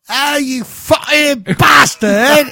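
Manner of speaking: slow, high, shouted fake British swearing; mock British curse. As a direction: roar it like a bad British football fan. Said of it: "I just sit there and go".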